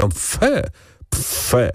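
high then dropping tuts of disapproval; disgusted. A narrator's reading. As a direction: show prim disgust without raising volume. Count shows disgust at her language.

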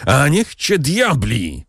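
mild hammed-up yell of rage; shouted curse. A narrator's reading. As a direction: spit the curse out as he draws the knife. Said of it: "wrzasnął Kadrus".

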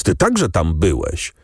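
usual speaking voice

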